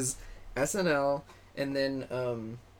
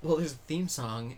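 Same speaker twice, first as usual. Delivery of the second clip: ordinary delivery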